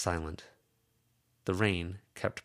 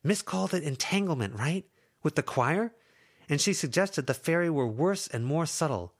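put-on higher young woman's voice, frustrated and pleading; Lucy's voice; 10 semitones higher, 4 dB louder than usual. Lucy scolding her two friends, higher frustrated voice.

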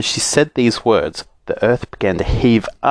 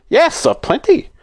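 much higher, proud; saucepan man voice. A narrator's reading. Saucepan man boasting to Moonface, high.

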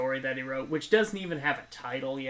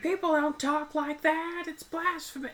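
mock Annie; 13 semitones higher than usual. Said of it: "she's like".

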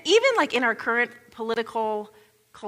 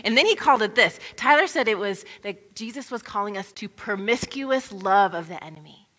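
ordinary talk

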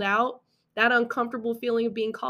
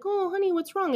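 higher, coaxing parent voice; mock concerned parent; 10 semitones higher than usual. Parent noticing the sulking child, higher coaxing voice. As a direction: play the parent cooing over a pouting child. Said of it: "And then they were like".

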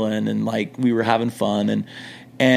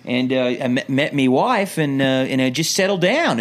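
higher-pitched put-on Australian voice; Australian accent; 4 semitones higher than usual. Fake expat explaining himself at the window, Australian accent. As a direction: keep up the Australian act. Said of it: "I kept the act up".

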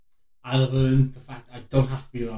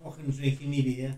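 put-on Yorkshire accent; 6 dB quieter than usual. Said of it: "Trying to do a Yorkshire accent".

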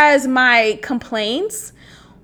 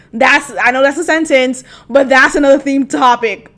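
usual speaking voice

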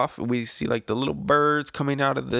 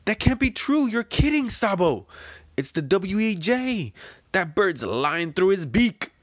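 high, loud voices in disbelief; shocked revolutionaries; 9 semitones higher, 3 dB louder than usual. Acted. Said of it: "They're like".

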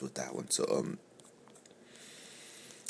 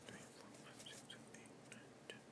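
whispered counting; 31 dB quieter than usual. Real, not acted. Counting days under breath.

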